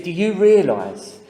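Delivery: stern fellow patient demanding attention; emphatic challenge. Retold or imitated. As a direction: grab the whole room's attention. Fellow patient silences bickering group.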